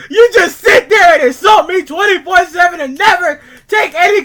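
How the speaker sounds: screamed, high-pitched accusations, dragged out word by word; furious rant. Acted. Freddy raging at his barnyard friends in his letter, furious screaming. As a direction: scream it at the friends who wronged you.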